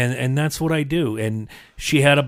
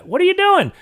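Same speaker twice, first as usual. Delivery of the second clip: daughter's question; 3 dB louder than usual. Retold or imitated. Daughter asks why he leaves.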